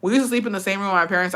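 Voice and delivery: ordinary talk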